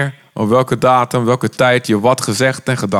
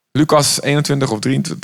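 usual speaking voice

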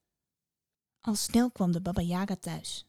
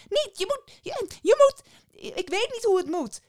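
put-on very high, loud flustered boy; boy crying out; 4 dB louder than usual. A narrator's reading. Boy cries out in mock confusion to the witch. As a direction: blurt it out in mock panic. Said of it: "riep de kleine jongen uit".

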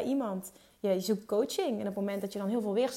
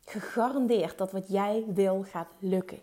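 ordinary delivery

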